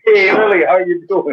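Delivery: put-on high, loud cheery hello to a kid; big bright welcome once he gets her name right; excited greeting. Guest cheerfully greets the student Lily, high and loud.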